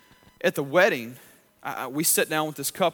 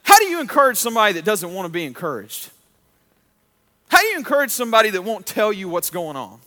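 louder, higher blurted questions; imitated frustrated plea, louder; 5 semitones higher, 9 dB louder than usual. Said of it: "blurted out".

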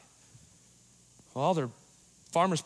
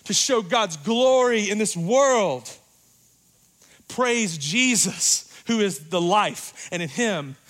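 rising, louder, higher preacher's climax; preaching at full force; 6 semitones higher, 8 dB louder than usual. Acted. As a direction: build to a shouted praise, slowing on the last words.

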